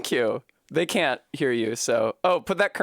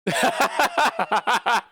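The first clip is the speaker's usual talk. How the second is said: high, rapid burst of laughter; real laughing; 9 semitones higher, 3 dB louder than usual. Real, not acted. Streamer laughing at partner off camera, real high laughter.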